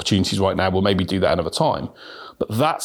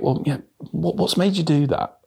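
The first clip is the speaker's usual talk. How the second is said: gentle curious tone; 7 semitones higher than usual. Shown as a demonstration. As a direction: ask it as if you truly care to know. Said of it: "That tonality difference".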